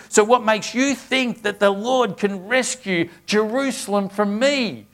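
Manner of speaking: scripture read as an enemy's loud, bold taunt. Acted. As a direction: call it up to the wall with swagger. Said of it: "louder and with more boldness".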